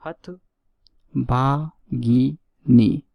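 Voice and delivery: हतभागिनी drawn out very slowly, syllable by syllable; teacher stretches हतभागिनी slowly for the class; slow spelled-out word. Shown as a demonstration. Teacher spells out the word slowly for students. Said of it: "देखिए important word ये भी".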